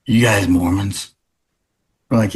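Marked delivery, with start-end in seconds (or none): 0.1-1.1 low, gruff mock drunk voice; drunk stranger. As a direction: growl it like a suspicious drunk. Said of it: "He's like".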